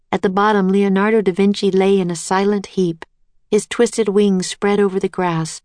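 ordinary delivery, nothing marked

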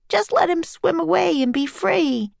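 high, earnest plea; pleading. A narrator's reading. Jack asking freedom for the octopus, pleading.

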